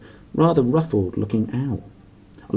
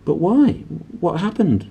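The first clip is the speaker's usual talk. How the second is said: urgent; 8 semitones higher than usual. A narrator's reading. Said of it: "Harry asked urgently".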